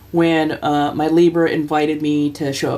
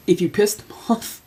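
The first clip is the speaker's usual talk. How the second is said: usual speaking voice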